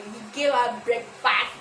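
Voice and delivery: slow, gave pressed hard; stress on gave. Real, not acted. Scolding the boyfriend over breakfast, gave stressed. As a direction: lean on gave, slowly. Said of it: "let me not spoil".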